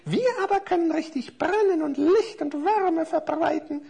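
hohe, prahlerische Figurenstimme; aufgeblasene Kerzen. Acted. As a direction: stolz und herablassend angeben. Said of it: "blusterten sich die Kerzen vor Stolz so auf". (hoch und eingebildet prahlend)

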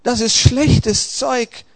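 high, slow anguished protest. A narrator's reading. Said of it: "protestierte er".

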